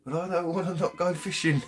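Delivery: put-on high, whiny toddler protest; little daughter's voice. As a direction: mimic a three-year-old refusing bed. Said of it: "My little girl".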